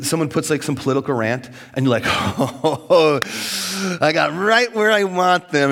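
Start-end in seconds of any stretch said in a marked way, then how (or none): 2.1-5.7 slow, louder mock gloat; smug gloating. Preacher mocks a Facebook poster, slow smug gloat. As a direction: savor the imagined win, hammed up.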